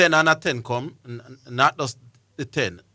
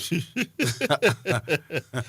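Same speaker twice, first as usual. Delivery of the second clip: a real, private chuckle at a running joke; amused chuckle to himself; laughing; 4 semitones higher than usual. Real, not acted. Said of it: "Why am I laughing?".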